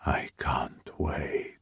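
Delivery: low, slow, soft whisper; deep whisper. Acted. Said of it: "Alessio's deep voice saying".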